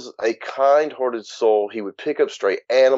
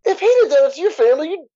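imitating dad's outburst. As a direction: shriek it out like the father snapping back. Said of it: "my dad looks up like".

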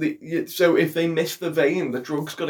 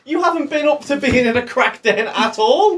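high, louder indignant ranting; indignant accusation; 9 semitones higher, 4 dB louder than usual. Real, not acted. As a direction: call out his made-up story, fired up. Heated mock accusation.

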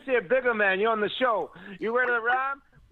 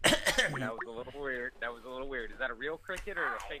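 deep voice; 12 semitones lower, 6 dB quieter, faster than usual. Acted. Deep put-on voice.

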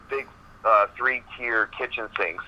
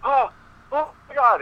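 much higher, rising panic cries; retold pained scream. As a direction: squeal it out like sudden burning pain. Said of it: "And he starts screaming".

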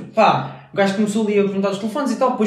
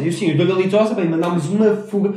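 normal talking voice

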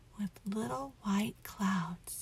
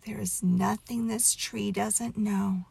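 usual speaking voice

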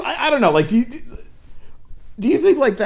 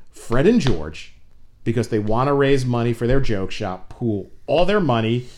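ordinary talk

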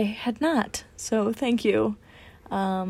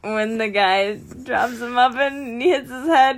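normal talking voice

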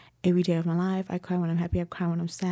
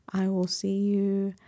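normal talking voice

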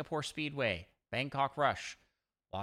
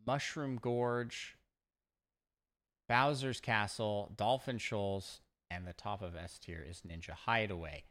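ordinary delivery

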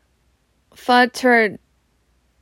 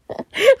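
high burst of laughter at herself; embarrassed laugh. Real, not acted. Reader laughing at her own wife voice. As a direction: laugh off the silly voice. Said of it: "Why did I do that?".

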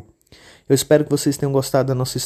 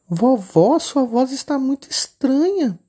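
put-on much higher, childlike little girl voice; 12 semitones higher, slower than usual. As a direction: play the innocent little girl. Menina desconfiada. (menininha de voz fininha e aguda)

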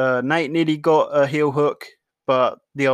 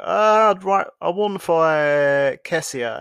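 higher, slow, drawn-out imitation of a fighter stalling; mock hesitant reply; 4 semitones higher, slower than usual. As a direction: draw it out, mocking his evasiveness. Mocking Usman's dodging reply.

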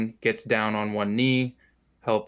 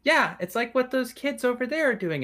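put-on very high child voice; high-pitched kid pointing something out; little elven girl; 14 semitones higher than usual. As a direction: explain helpfully as a small girl. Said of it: "and she points to".

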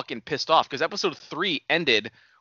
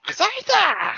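high put-on monster name-call; creature cry; 14 semitones higher than usual.

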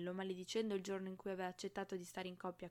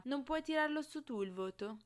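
contemptuous; 6 semitones higher, 5 dB louder than usual. A narrator's reading. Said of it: "chiese sprezzante".

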